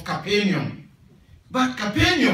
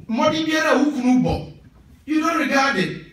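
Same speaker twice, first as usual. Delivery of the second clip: raised, exasperated complaint; scolding shout; 4 semitones higher than usual. Retold or imitated. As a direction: throw it at him in frustration.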